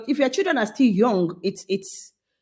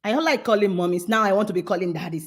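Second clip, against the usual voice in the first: ordinary talk, nothing marked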